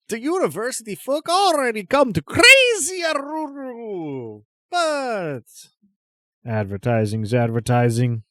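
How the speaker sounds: high, loud showman with a howled name; eccentric trader. Acted. Crazy stallholder giving in to the buyer's bargain, loud and eccentric. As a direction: proclaim it loudly, leaping high at start and end. GM plays stallholder closing the deal.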